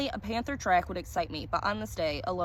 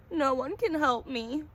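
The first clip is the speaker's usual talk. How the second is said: slow, higher hopeless voice; despairing; 6 semitones higher, slower than usual. A narrator's reading. Cursed collector answers the stranger, slow and despairing. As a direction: let each word sink, hopeless. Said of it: "he said".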